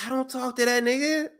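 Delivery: a light jab at Paul; mocking Paul. Retelling Paul Heyman's line, lightly imitated. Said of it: "And Paul's like".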